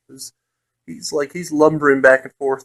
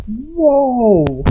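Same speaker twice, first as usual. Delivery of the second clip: a high, yelped whoa, imitated; startled whoa; 8 semitones higher than usual. Ogre struck by Edwin's rapier, high startled yelp. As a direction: mimic the ogre jolting in shock. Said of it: "He's like".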